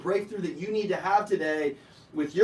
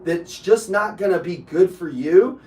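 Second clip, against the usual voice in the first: retold warning to client; 4 semitones lower, 6 dB louder than usual. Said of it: "I said".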